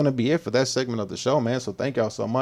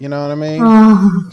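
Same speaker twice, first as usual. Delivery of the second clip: mock moan of relief; 3 semitones higher, 12 dB louder than usual. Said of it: "She's gonna be like".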